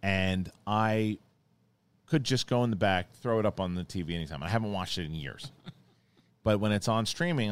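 ordinary delivery

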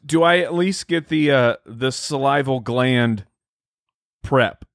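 usual speaking voice

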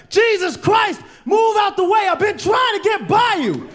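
stuck dancer yells at the guy blocking him, shouting; angry shout. Retold or imitated. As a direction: bellow at him to get out of the way. Annoyed dancer yells to pass.

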